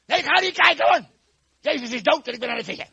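high, loud, exasperated shout; cross refusal. Acted. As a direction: refuse loudly, fed up with him. Peter shouting John down again.

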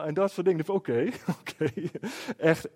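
ordinary talk

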